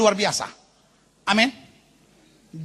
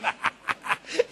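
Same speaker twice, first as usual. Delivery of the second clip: softer trailing laugh; chuckle; 7 dB quieter than usual. Real, not acted. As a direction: let the laughter die away softly. Laughs at own joke. (sisa tawa pelan)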